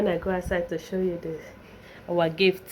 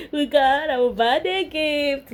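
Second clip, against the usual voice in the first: usual speaking voice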